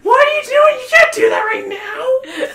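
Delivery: very high, loud furious shriek; screams in anger. Acted. Patty shrieking angrily at the rude player. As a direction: scold them in a high screaming rage. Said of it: "Patty just screams in anger".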